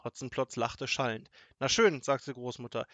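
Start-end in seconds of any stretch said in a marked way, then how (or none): 1.6-2.0 loud, raised retort; huffy. A narrator's reading. Grandmother gives up pleading with the robber, huffy. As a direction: announce defiance in a huff.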